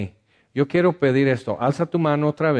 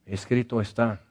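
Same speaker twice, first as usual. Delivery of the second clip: earnest pastoral prayer. Acted. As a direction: pray gently over the sad. Leads prayer for the sad.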